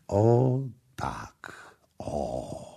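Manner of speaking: soft whispered o tak. Acted. Prince showing Kama the embrace, whispering. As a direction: breathe it close, tender.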